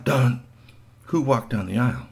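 plain reading voice

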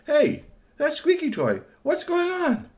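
very high-pitched witch's cry; startled witch. A narrator's reading. Witch calls out on seeing toy.

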